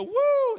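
high, loud whoop; mock cheer. People seeing God do amazing things, whooping. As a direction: whoop like an amazed fan.

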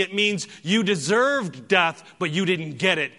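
high, loud, impassioned sermon delivery; full-force preaching. Real, not acted. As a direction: proclaim it to the whole congregation, punching key words.

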